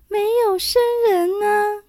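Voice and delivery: thin high little voice. A narrator's reading. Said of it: "细声细气地回答说".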